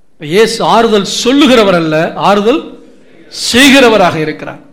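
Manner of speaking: normal talking voice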